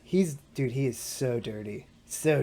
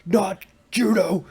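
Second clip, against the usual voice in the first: mock judo bro; 8 semitones higher, 11 dB louder, slower than usual. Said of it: "Everybody who does judo is like".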